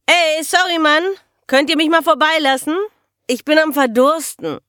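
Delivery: high, loud yell to get past; yelling over club noise. Acted. Stranger pushing past at a crowded bar, yelling. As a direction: holler it right into someone's ear. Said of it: "brüllte mir eine Stimme ins Ohr".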